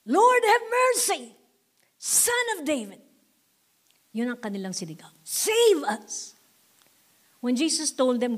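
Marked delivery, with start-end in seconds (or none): 0.0-3.0 high, slow pleading cry; imitated shout for mercy. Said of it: "they shouted". 5.2-6.4 high plea for rescue; imitated plea. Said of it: "they shouted".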